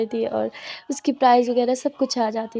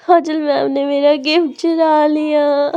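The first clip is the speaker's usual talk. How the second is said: slow, louder tattling whine; slow, louder childish complaint; whiny complaint; 3 semitones higher, 6 dB louder, slower than usual. Acted. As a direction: drag it out like a hurt child telling on someone.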